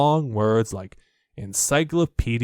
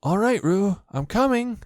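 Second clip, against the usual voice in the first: calling out; 9 semitones higher than usual. A narrator's reading. Christopher Robin calling to Roo while hurrying, high call. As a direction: call ahead as you run. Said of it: "called Christopher Robin".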